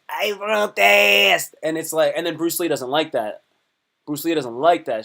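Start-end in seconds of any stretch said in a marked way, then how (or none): 0.1-1.6 very slow, drawn-out put-on voice; caricatured neighbor voice. Retelling the film's upstairs neighbor, slow put-on voice. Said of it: "he goes".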